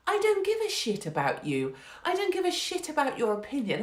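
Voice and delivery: normal talking voice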